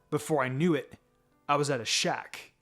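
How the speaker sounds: ordinary delivery, nothing marked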